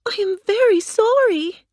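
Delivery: put-on high, apologetic girl voice; a girl apologizing quickly; sorry Dorothy. A narrator's reading. Dorothy apologizing to the melting witch, high and contrite. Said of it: "Dorothy said".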